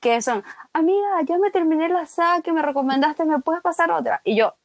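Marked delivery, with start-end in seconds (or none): 0.7-4.2 high-pitched imitation of a pleading friend; friend asking. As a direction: voice a friend's request, much higher. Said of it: "mensajes en Facebook guardados que son". (amiga pidiendo, imitada con voz aguda)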